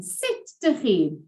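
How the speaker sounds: usual speaking voice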